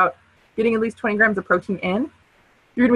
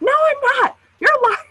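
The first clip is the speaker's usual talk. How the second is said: high, louder mock protest; much higher, louder hammed-up quarrel; mock argument; 4 dB louder than usual. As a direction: play the wife snapping back in a fight. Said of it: "she started a fight with him".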